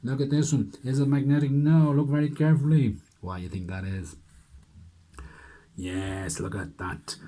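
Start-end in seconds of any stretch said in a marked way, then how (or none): 0.0-3.0 louder, higher showman's call; excited reveal. Acted. Magician loudly reveals the trick to the children, excited. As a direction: announce it like a showman unmasking the trick.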